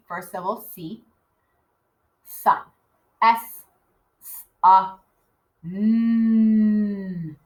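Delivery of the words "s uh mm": "S, uh, mm" with the last hum held long; sounding out. Shown as a demonstration. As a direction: separate letter sounds "S, uh, mm". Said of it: "those are the sounds we heard".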